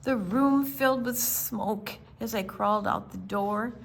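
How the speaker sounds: ordinary delivery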